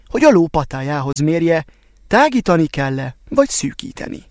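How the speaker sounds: ordinary delivery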